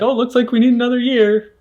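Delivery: high mock orthodontist; chirpy bad-news dentist. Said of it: "hear them say, like".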